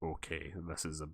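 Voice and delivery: quiet, awkward aside; muted wary reaction. Retold or imitated. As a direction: say it low and under your breath, uneasy. Muted awkward reaction to her silence.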